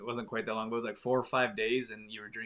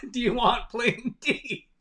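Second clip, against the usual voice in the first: high, loud, slow, laughing through it; laughing mock offer; 13 semitones higher, 10 dB louder, slower than usual. Laughing while mimicking the mom's plain tea offer. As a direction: imitate the offer while cracking up.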